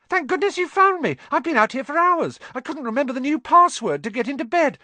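put-on very high, loud, squeaky boy; frantic relief. A narrator's reading. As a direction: gush it out in a high panic. Stranded boy wakes, found at last.

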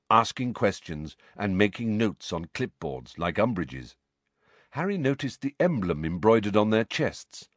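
ordinary delivery

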